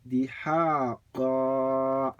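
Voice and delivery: drawn-out, held final vowel on "haqqa"; recited word-final stop. Shown as a demonstration. Teacher showing students a separate reading, drawn-out recitation stop. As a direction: read "haqqa" slowly, stopping on a long open vowel. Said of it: "if you were to read".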